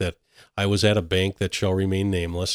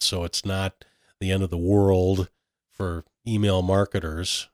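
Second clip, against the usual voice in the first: usual speaking voice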